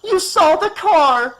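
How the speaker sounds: very high, loud, slow scream; desperate mother screaming at the doctor. Acted. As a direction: scream it at him in panic. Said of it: "she screamed".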